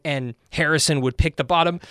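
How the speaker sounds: ordinary delivery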